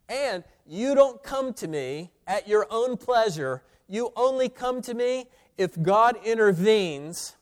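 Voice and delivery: high, insistent pulpit delivery; forceful preaching. Real, not acted.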